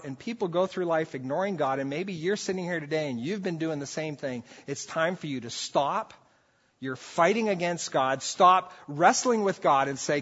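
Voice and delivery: ordinary delivery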